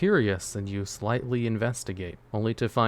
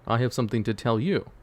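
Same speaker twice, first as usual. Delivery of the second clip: level, composed answer in usual pitch; Norden's measured reply. Acted. Norden answers the newcomer, measured and composed. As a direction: turn it back evenly on the visitor. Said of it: "he's like".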